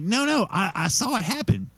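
insistent protest. Retold or imitated. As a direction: protest it high, as if not believed.

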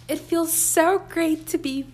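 loud and high with relief; loud, passionate podcast voice; talking loudly. Real, not acted. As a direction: let it ring out after whispering. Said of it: "I'm talking so loudly".

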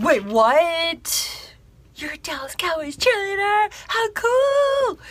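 very high, amazed squeal of delight; fangirling. Retold or imitated. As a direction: gush like a starstruck fan, pitch soaring. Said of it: "I was all hashtag fangirling".

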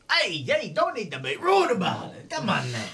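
high, squeaky goblin talking fast to get away; a sly little goblin smoothing things over; nervous goblin. Acted. Goblin caught ransacking the house, squeaky nervous voice.

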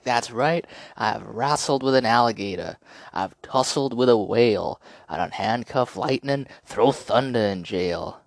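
hammed-up boxer bravado; Ali poem. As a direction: rhyme it like a cocky champ. Reciting Ali's boast poem.

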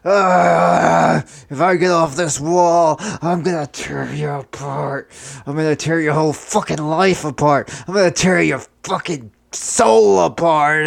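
strained, groaning vow of revenge; dying man's threat. Acted. Impaled Bob threatening his killers, groaning as he dies. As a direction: groan it out as your last words.

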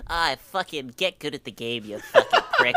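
internet troll. Retold or imitated. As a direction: sneer it like a toxic chat troll. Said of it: "Such a good troll voice".

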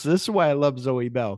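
ordinary delivery

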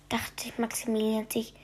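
hushed spooky storyteller whisper. A narrator's reading. Narrator telling a bedtime story in a spooky whisper. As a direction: breathe it like a secret in the dark.

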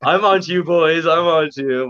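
mock warning.

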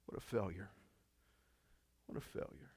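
soft, deflated murmur after an outburst; crushed self-reproach. Acted. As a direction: let it sink, hushed and defeated.

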